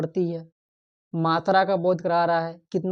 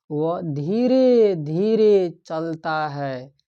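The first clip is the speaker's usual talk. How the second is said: slowly, stretching each word; slow demo; much slower than usual. Shown as a demonstration. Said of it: "उसका चलने का तरीका क्या है". (धीमी गति से बोलना)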